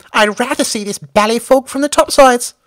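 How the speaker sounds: put-on high, loud camp young airman; a swooning falsetto for the bored pilot; high plummy fop voice; camp Algy voice. A narrator's reading.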